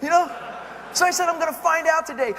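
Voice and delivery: fired up about finding out; half-shouting with excitement; excited. Acted. Excited about the plus sign.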